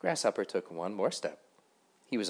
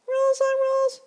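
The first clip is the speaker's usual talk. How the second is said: very high, loud squeaky little mosquito; a tiny self-important ferryman insisting on rules; 12 dB louder than usual. A narrator's reading. Mosquito ferryman lecturing Grasshopper, very high and loud.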